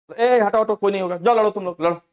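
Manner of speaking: loud, high scornful dare. Retold or imitated. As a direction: shove them off, daring them to fight. Senior Ashish dares fighters. (ऊँची आवाज़ में ललकार)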